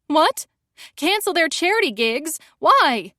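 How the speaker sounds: high, loud horrified squeak; shrill squeak. A narrator's reading. Narrator horrified at the cancelled charity gigs, shrill squeak. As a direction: squeak it out in shock. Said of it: "My voice rises to a shrill squeak".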